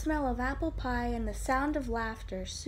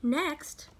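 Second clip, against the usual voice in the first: usual speaking voice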